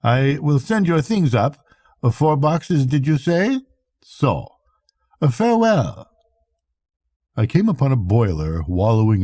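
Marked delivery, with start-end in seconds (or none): 0.0-6.1 a weathered captain, matter-of-fact about death; hint of a Swedish captain, dry and grim. Swede's parting words.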